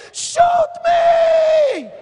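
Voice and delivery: very high, very loud defiant shout; full-voiced cry of shoot me at the gunmen. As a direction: yell it at the gunmen, daring them. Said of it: "I began to shout at him".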